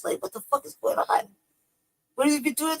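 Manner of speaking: high incredulous question; high-pitched disbelief. Real, not acted. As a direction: sound baffled and appalled. Disbelief at the streamer.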